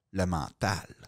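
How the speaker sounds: low, soft, weighty pronouncement; hushed, low acted pep-talk punchline; grand speech. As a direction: drop right down and deliver it with mock solemnity. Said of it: "t'as le grand discours".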